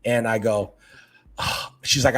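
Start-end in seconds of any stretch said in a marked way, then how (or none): none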